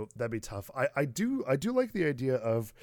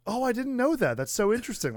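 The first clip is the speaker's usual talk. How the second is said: much higher, louder, gushing and amazed; mock fascinated; 11 semitones higher, 4 dB louder, faster than usual. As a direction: act delighted and wide-eyed at the news. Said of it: "It's gonna be me going".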